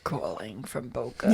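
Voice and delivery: low, slow, soft gravelly impersonation; Adrian, gravelly. Acted. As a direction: put on a deep, quiet rasp. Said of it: "It's, it's Adrian".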